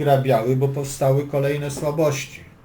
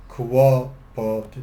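ominous drawn-out; 4 dB louder, much slower than usual. Real, not acted. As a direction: stretch the word slowly, ominously. Trouble starts in chess line.

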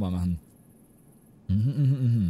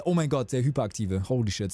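usual speaking voice

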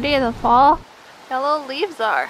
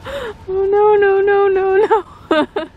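alarmed cries; 7 semitones higher, slower than usual. Real, not acted. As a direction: cry out in alarm. Partner slipping into water.

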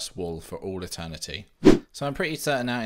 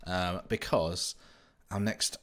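ordinary talk, nothing marked

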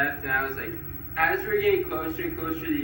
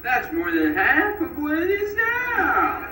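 much higher, mock feminine customer-service voice; woman-like guy; 12 semitones higher, 6 dB louder, slower than usual. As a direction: squeak it up like the agent he took for a woman. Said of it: "this guy who I thought was a woman".

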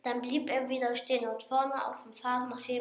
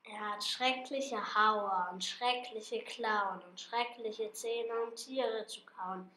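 spooky hush; 2 semitones lower, 9 dB quieter than usual. Acted. Mouse scaring the fox with the monster, much softer. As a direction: whisper it like a ghost story. Describing monster's teeth, hushed.

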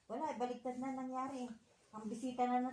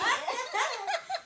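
tickled giggle; 13 semitones higher, 6 dB louder than usual. Real, not acted.

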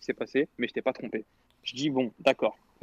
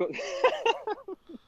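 high laugh. Real, not acted. Teller bursts out laughing.

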